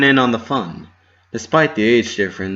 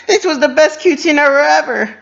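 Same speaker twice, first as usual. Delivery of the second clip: high, loud squeal of a thrilled filly; excited cry; 9 dB louder than usual. A narrator's reading. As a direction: squeal it out with pure joy. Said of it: "Minty cried".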